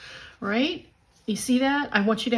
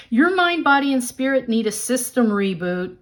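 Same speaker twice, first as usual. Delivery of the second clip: louder, higher card-book reading; reading aloud; 4 semitones higher, 5 dB louder than usual. Shown as a demonstration. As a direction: read the card message with emphasis. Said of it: "read you the message out of the book".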